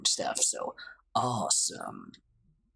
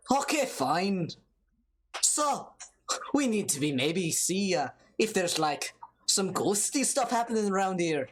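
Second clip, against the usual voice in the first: higher-pitched, lilting Irish character voice; mock-Irish, pitched up; attempted Irish accent; 5 semitones higher than usual. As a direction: put on a hammed-up Irish brogue. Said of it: "If this is Scottish, I'm so sorry to all of these Irish people".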